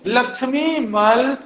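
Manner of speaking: लक्ष्मी मल सिंघवी समिति broken up slowly; slow spelled-out name. Shown as a demonstration. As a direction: drill each word slowly. Teacher drilling name slowly. (धीरे-धीरे रटाना)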